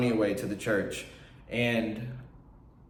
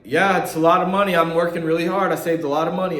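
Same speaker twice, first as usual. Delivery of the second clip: higher, louder, bluffing that the savings are big; fake confident lie; 5 semitones higher, 5 dB louder than usual. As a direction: sell the lie with loud cheer. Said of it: "I had to lie and tell them".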